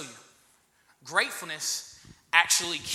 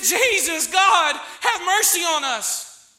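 a raised, pleading plea for mercy; loud cry for mercy; 9 semitones higher, 6 dB louder, slower than usual. Acted. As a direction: cry out louder and slower, pleading. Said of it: "They cried out".

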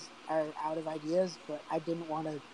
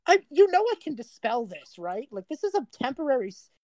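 put-on sneering, petulant teen, ending in a loud high jeer; bratty Oko; 7 semitones higher, 6 dB louder than usual. Oko sneering at the party after the polymorph. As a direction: whine like a spoiled brat, then blurt the insult. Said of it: "Oko looks at Janice and just goes like".

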